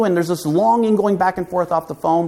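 ordinary talk, nothing marked

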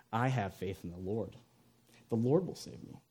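soft, low, unruffled voice; serene faith. Acted. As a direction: calmly assure them, very quietly. Stranded man's serene faith in joke.

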